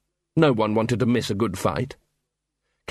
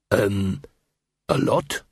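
usual speaking voice

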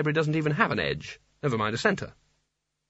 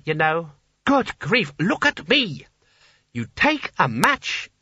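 raised, emphatic lecturing voice; Sconner, exasperated leader; 5 semitones higher than usual. Acted. Sconner shows wizards how to light matches, exasperated. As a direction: spell it out with high, slow impatience.

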